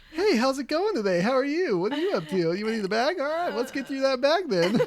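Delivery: high, loud, put-on friendly greeting; cheery cashier. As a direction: greet the customer in a perky high-pitched character voice. Acted friendly cashier persona for next customer.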